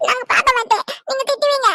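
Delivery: put-on a small boy pleading not to eat, half sobbing; whining child. Child voice continues. (சாப்பிட மாட்டேன் என்று அழும் சிறுவன் குரல்)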